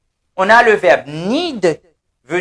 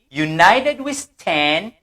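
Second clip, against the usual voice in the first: "United we stand, divided we fall" dit lentement, syllabe par syllabe; slow model reading; slower than usual. Shown as a demonstration. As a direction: read the motto slowly for the class to repeat. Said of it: "L'union fait la force". (lentement, "United we stand, divided we fall")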